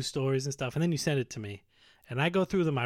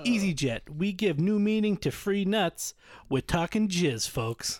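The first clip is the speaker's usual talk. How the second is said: slow, higher announcer pitch; mock ad slogan; 5 semitones higher, slower than usual. Reading joke airline slogans to co-host, mock ad read. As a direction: sell the slogan like an advert. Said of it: "EasyJet slogans".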